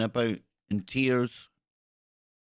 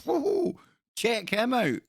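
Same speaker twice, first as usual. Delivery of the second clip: high mock whoa, pitched way up; imitating a bystander; 11 semitones higher, slower than usual. Mocking the other guy's gawking reaction, high mock voice. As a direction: squeak it like a gawking onlooker. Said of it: "you thought the other guy was like".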